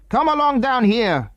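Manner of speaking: high, firm order called out; a stern man gives a command. A narrator's reading. Man orders the girls to come down to him, firmly. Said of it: "commanded the man".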